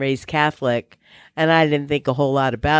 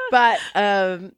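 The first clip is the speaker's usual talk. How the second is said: ordinary talk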